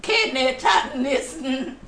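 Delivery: put-on slow, loud old granny voice. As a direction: play a bossy grandmother on the phone. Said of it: "a granny voice".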